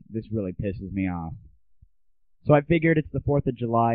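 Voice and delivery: usual speaking voice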